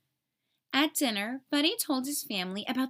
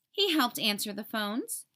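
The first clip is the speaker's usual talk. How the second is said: plain reading voice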